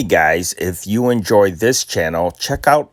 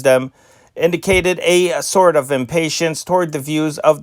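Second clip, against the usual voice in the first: put-on brisk old-time newsreel read, higher than his chat; news read; 5 semitones higher than usual. Host reading old newspaper stories to listeners, crisp news announcer read. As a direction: announce each item like a radio newsman.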